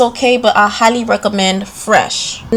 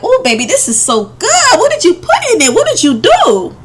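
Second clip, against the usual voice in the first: impressed husband voice; 7 semitones higher than usual. Retold or imitated. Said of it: "My man gonna be like".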